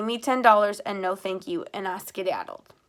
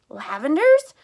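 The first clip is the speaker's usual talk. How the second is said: higher, louder, rising disbelief; surprised cry; 7 semitones higher, 9 dB louder, slower than usual. A narrator's reading. Mother hears her son's shoes made the prints, shocked cry. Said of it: "Miss Rose cried".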